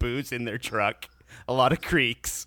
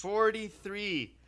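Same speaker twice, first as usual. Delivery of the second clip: drawn-out number; slow, disgusted; 6 semitones higher, 4 dB quieter, much slower than usual. Real, not acted. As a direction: stretch it out in disbelief. Complaining about desert heat.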